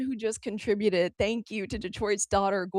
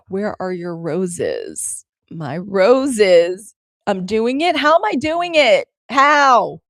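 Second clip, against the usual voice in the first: loud, excited searching; 12 dB louder, slower than usual. Real, not acted. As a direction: call out eagerly, louder. Excited searching for roses gift.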